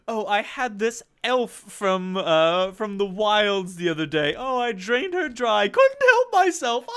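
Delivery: high, loud gushing brag about draining an elf; vampire debutante bragging. Acted. Vampire noble bragging to her sycophants, high and loud. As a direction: preen and giggle over the kill.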